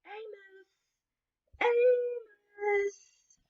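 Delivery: high, long call across the street. Acted. Owners calling out for lost dog.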